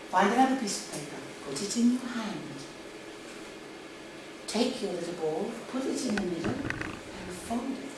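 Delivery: soft, hushed instructions; teacher to little children. Acted. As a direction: guide small children quietly through a craft. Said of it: "I said to my students".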